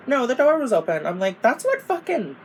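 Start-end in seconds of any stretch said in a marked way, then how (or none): 0.1-1.1 high, louder mocking mom. Mom reassures her, quoted. 1.4-2.4 high incredulous squeak; incredulous outburst. Retold or imitated. Outraged retort to mom.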